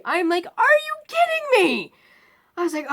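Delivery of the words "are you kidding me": high, loud, drawn-out disbelief; exasperated outburst. Retold or imitated. Patient sees the screamer wheeled in beside her, exasperated outburst. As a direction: cry out in loud, incredulous protest.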